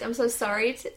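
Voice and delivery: usual speaking voice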